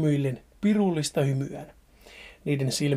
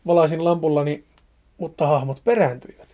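ordinary delivery, nothing marked

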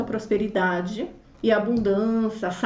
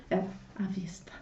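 breathless whisper of disbelief; 4 semitones lower, 13 dB quieter than usual. Real, not acted. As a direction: barely voice it, stunned. Repeating the cash price in disbelief.

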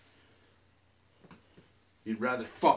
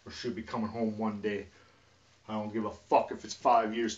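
ordinary talk, nothing marked

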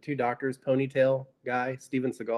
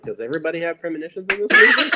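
fast, louder incredulous question; excited disbelief; 4 semitones higher, 8 dB louder, much faster than usual. Retold or imitated. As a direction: rattle it off in disbelief. Retelling his own baffled note.

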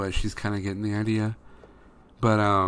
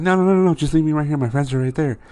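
daughter protesting; 7 semitones higher, 6 dB louder, faster than usual. Retold or imitated. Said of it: "She's like".

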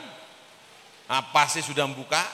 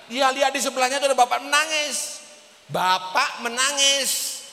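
dramatic storytelling; 11 semitones higher, 7 dB louder than usual. A narrator's reading. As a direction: announce the sight high and emphatic, stretching the ending. Preacher dramatizes seeing crying man. (bercerita dramatis, nada tinggi)

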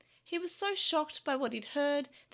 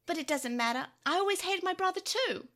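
spiteful dismissal, climbing in pitch; sarcastic; 5 semitones higher than usual. A narrator's reading. Takeru disowns his brother to Tokomon, sarcastic. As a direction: toss it off with a rising sneer. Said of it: "Takeru said sarcastically".

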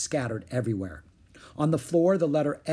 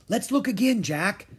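put-on higher, eager child's plea; eager urging; 9 semitones higher, 3 dB louder than usual. A narrator's reading. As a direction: urge a friend to try once more. Girl urging her friend onward.

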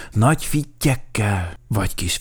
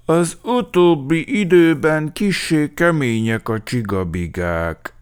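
mournful little complaint, trailing down; melancholy; 7 semitones higher, 4 dB louder than usual. A narrator's reading. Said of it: "mondta mélabúsan".

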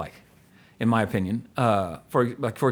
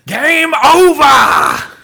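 movie shout; 13 semitones higher, 11 dB louder, much slower than usual. Retold or imitated. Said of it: "I'm like".

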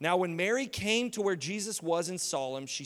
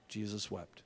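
very low, soft and solemn; hushed recitation; 9 semitones lower, 11 dB quieter than usual. Real, not acted. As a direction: let the verse fall quietly. Solemnly recites verse to memorize.

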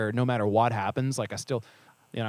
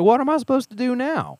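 much higher and louder, at a loss; exasperated; 13 semitones higher, 7 dB louder than usual. Retold or imitated. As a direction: throw up your hands in confusion. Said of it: "I'm like".

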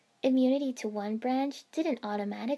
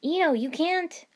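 alarmed; 7 semitones higher, 7 dB louder than usual. Acted. Choji crying out in alarm.

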